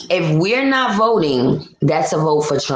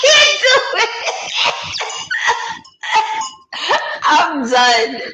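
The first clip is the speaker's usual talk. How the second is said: real laughing fit; 12 semitones higher, slower than usual. Real, not acted. Host giving up on the accent, laughing helplessly. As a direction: crack up at your own failure, voice shooting up.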